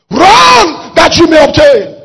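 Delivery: high, loud, drawn-out preacher's shout; shouted exhortation. Real, not acted. Preacher urging the congregation with scripture, shouted exhortation. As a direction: shout the command slowly at full force.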